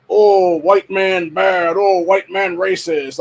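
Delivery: slow, high, loud sneering parody of a slogan; mocking slogan. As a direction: drag out each word to mock the chant. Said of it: "indoctrination of".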